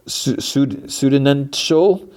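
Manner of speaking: slow, halting attempt at a hard word; stumbling over a word. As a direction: sound it out slowly, pitch up.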